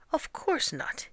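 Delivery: high-pitched, dismissive retort; indignant correction. A narrator's reading. Master rejects the wrong name.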